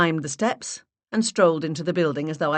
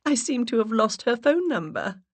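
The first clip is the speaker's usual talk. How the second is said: higher, sheepish and sweet; high coy voice with a hint of embarrassment; embarrassed put-on voice; 7 semitones higher than usual. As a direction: play it coy and a little embarrassed. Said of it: "I gave an embarrassed giggle".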